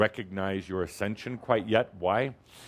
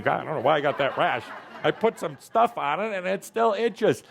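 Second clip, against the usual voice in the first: a pitched-up, laughing mock of a fretful person; whiny complainer; 6 semitones higher than usual. Teacher mocking a student fretting over a rash, laughing whine. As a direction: play the itchy doubter with a laugh.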